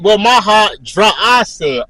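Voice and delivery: ordinary delivery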